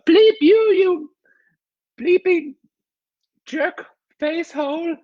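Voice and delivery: very high, slow mock curse; screechy kid's parody of an angry mom; mimicking mom swearing. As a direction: draw out each censored insult, hammed-up. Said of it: "I just did the exact personation".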